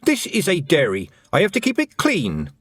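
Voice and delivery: put-on high young girl's surprise; a sharp, startled girl; Tiffany voice. A narrator's reading.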